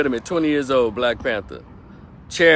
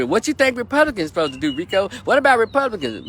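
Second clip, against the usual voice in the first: mock questioner; 6 semitones higher, faster than usual. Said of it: "please don't ask me".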